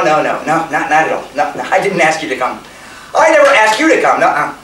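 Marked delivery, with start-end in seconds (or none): none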